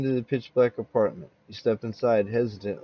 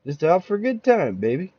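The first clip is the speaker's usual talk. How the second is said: higher, louder, brash come-on; cocky swagger; 6 semitones higher, 4 dB louder than usual. Acted. Man in a spell answers the bar woman, cocky and brash. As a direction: play the big shot with a grin.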